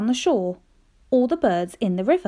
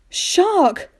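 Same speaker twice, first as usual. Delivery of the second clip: sudden higher, louder alarmed exclamation; alarmed cry; 6 semitones higher, 4 dB louder than usual. Retold or imitated. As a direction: blurt it out in shock. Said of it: "I thought".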